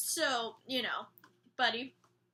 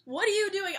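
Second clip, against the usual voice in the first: coach scolding; 6 semitones higher, 5 dB louder than usual. Retold or imitated. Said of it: "he's like".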